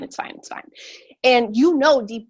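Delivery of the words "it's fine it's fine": whispering to convince yourself it's fine; whispered denial. Retold or imitated. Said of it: "And you're like".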